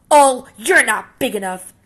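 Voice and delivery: loud, high, dismissive sneer; scornful brush-off. Acted. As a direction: wave him off to save face. Grouchy ladybug backs off.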